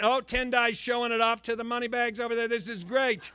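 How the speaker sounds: normal talking voice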